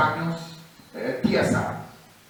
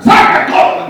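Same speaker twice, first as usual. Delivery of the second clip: orden gritada; 15 dB louder than usual. Retold or imitated. Ordering the crowd out.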